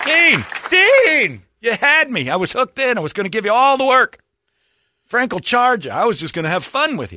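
high, loud wounded tirade; mock-outraged pleading. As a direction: wail at the man who let you down. Imagined tirade at Dean.

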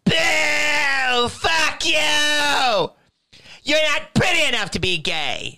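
mock the hecklers booing him off; heckling crowd. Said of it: "They're like".

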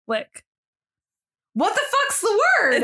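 high, slow laughing giggle; real giggling fit; laughing in frustration. Real, not acted.

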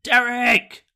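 calling from far away. Acted. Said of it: "I'm gonna shout over to Derek".

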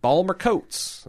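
slow, high-pitched go at a Baltimore accent. Shown as a demonstration. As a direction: stretch it out in Baltimore dialect. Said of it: "that's the most accent I can get".

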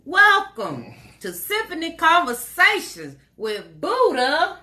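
loud, high announcer intro; big drawn-out host opening; show welcome. Shown as a demonstration. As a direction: announce the show, stretching the last name. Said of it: "So I'm gonna say it".

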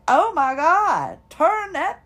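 loud, slow, fed-up "oh my God"; fed up and wanting it off now; exasperated outburst. Retold or imitated. To a stranger's annoying voice, irritated loud outburst. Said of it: "and you're just like".

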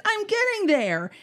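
high-pitched impatient protest; mock-impatient. Grandfather to interrupting granddaughter mid-saga, mock-impatient. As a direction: imitate a grandfather waving off interruptions.

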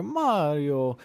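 high, drawn-out cartoon Italian accent; mock an overdone Mario-like Italian accent; Mario-style accent. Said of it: "he sounds like he's talking like Mario".

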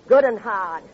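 loud, proud of the slap; forceful retort. Acted. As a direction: punch it out loud and hard. Recounting slapping her husband.

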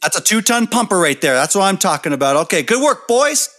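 high, loud, cocksure dad voice; mock-authoritative dad pronouncement; bandmate's dad. Imitating bandmate's dad in story.

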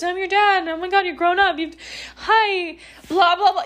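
high, mock-gushing dad voice; mocking the absent father's gushing greeting. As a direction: parody the fake-sweet reunion. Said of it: "He goes".

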